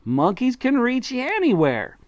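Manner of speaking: high, slow excited monkey; Mugglewump excited. A narrator's reading. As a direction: call it out, eager and high. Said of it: "shouted Mugglewump".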